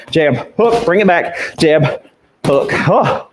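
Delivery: ordinary talk, nothing marked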